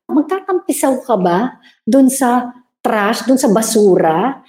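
usual speaking voice

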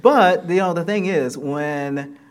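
laughing it off, then carrying on. Real, not acted. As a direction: laugh out loud, then keep talking. Laughing at getting beat up.

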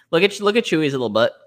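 usual speaking voice

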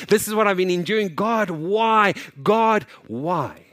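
loud, high anguished cry to God. Pretend. Father crying out to God, loud and anguished. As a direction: cry out why to heaven.

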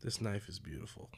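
creepy whisper. Acted. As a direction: breathe it like a creep. Creepy whisper about knife.

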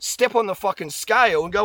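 ordinary talk, nothing marked

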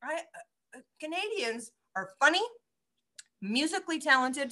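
talking while choking on a bite; strained voice fighting a cough; choking on a cranberry. Real, not acted. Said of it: "Sorry, I'm choking on a cranberry".

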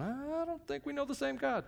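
high put-on sceptical voice; doubting listener. Doubter in the pews answering the preacher, high mocking voice.

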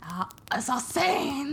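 slow, urgent whisper; excited whisper. Pretend. Friend whispers the punchline back dramatically. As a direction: hiss it out slowly like a reveal.

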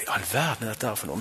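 a secret wondering whisper; hushed whisper. Retold or imitated. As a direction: whisper it, awed. Telling a stunned inner thought.

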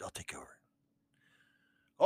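whisper. Acted. Joey whispers aside.

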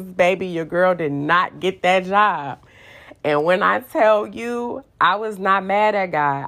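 ordinary delivery

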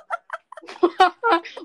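loud surprised laugh; burst of laughter. Real, not acted. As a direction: crack up loudly. Bursts out at dad remark.

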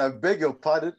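mock-offended, laughing through it; mock-offended reply to the coach, laughing; laughing retort. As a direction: feign offence while laughing. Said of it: "I said".

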